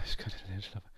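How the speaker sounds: a hint of a whimpering drunk; soft drunk whine. Drunk [name] begging the girl, soft and whiny.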